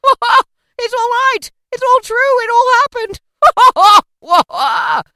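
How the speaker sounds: high, loud, uproarious laughing; splendid hearty laugh. A narrator's reading. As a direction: shout it between great peals of laughter. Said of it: "it was a splendid laugh".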